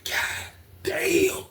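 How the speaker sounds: slow, voiceless whispered oath; hushed astonishment; stunned whisper. Retold or imitated. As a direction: whisper it, stretching the last word. Retelling his shocked reaction.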